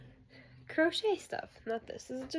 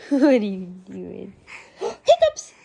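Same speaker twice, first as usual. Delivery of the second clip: very high, loud squeals of the word between slow coos; 6 semitones higher, 6 dB louder than usual. Real, not acted. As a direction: squeak "Hiccup" up high, then coo "you're so silly" slowly. Mom teasing hiccuping baby.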